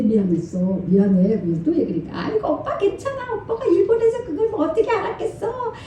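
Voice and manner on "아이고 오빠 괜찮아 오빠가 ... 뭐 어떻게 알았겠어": put-on high, laughing sweet young wife; sweet daughter-in-law. Daughter-in-law comforting her husband, high and sweetly laughing. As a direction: coo it sweetly and reassure him. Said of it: "막 이러는데".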